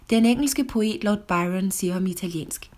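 usual speaking voice

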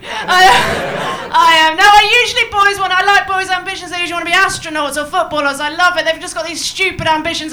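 loud over the laughter. Real, not acted. Comic talking loudly over the laughing crowd. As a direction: push the voice over the room.

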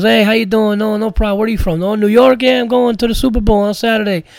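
put-on broken English in a thick Armenian accent; Armenian accent impression. Comic imitating the Armenian cigar man greeting them in a hammed-up Armenian accent. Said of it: "me and Matt Reese were imitating this guy".

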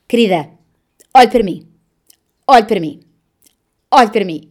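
loud, insistent imitated nurse; enfermeira amorosa. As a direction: imitate a nurse firmly coaxing a patient to focus. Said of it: "uma enfermeira amorosa". (enfermeira amorosa, a chamar com firmeza)

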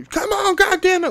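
high, loud mock scream; imitated scream. Imitating Cuba Gooding's breakdown, loud scream. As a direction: scream it like he's about to fall over. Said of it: "he's having a moment".

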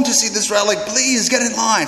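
high-pitched, imploring voice; beg, pitched right up; imitated pleading. Pilgrims beg the crowd after a long journey, pleading. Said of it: "there are certain ones that begin pleading".

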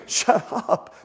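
mock embarrassed whisper; hushing bystander. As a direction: hush him under your breath. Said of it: "the people around Bartimaeus are telling him, they start saying".

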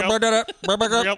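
loud, high goofy voice; silly mock accusation. Friends riffing on who did the murder, goofy mock accusation. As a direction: chant it in a dopey put-on voice.

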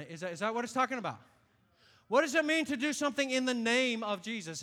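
ordinary talk

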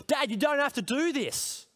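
a hint of an impatient kid in the back seat; imitating his child urging him through the light; mock kid complaining. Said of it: "my backseat driver said".

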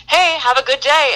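high, sunny greeting; a light imitation of a friendly southerner; cheery southern politeness. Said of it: "you would be like".

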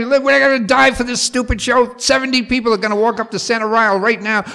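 loud, high-pitched panicked rant; cursing back. Retold or imitated. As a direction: yell back furiously at the director. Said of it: "I'm cursing back".

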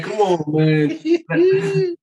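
high, drawn-out, fake-crying whine; mock whining protest. Brooklyn host protesting the gentrification jab, mock whine. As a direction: stretch it slow and high like a pretend sob.

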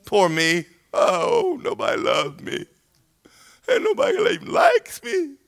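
hammed-up self-pitying wail, leaping very high; mock crying. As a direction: imitate a bawling, pitiful crybaby. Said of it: "you're gonna cry".

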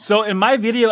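ordinary talk, nothing marked

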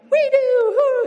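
very high, loud falsetto cheer; crowd roar. Acted. Crowd answering the tightrope walker, shrieked high cheer. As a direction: whoop it like a thrilled crowd.

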